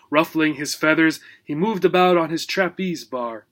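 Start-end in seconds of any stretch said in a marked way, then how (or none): none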